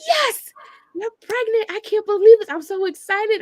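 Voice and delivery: excited doctor. Retold or imitated. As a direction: play the thrilled doctor breaking the news. Doctor voiced announcing pregnancy.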